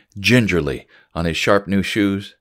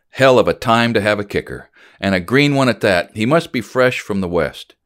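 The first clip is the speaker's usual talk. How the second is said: ordinary delivery, nothing marked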